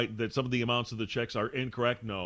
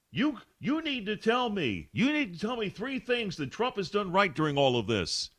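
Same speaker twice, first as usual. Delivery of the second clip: imitating a demanding host, higher pitch; mock her pushy demand, pitched up; demanding imitation; 10 semitones higher than usual. Host imitating Joy demanding answers, higher pushy voice. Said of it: "she demanded".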